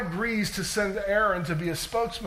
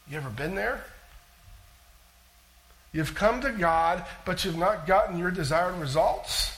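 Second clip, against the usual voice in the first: ordinary talk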